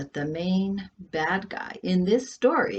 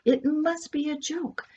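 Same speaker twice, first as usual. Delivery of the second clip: higher-pitched, more desperate repetition; higher anxious repeat; 10 semitones higher than usual. Acted. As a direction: repeat it higher, clinging to the idea. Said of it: "Andrea repeated this over and over".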